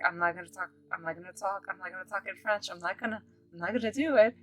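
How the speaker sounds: hushed, repeated refusal under the breath; super shy. Retold or imitated. Too shy to speak French to the doctor, hushed. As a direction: shrink back, very soft, as if to yourself. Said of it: "super shy".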